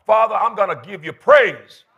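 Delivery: loud, fervent praise; heartfelt preacher's cry, punched loud; from the heart. Shown as a demonstration. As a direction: declare it to God with full force. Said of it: "Now speak it out of your heart".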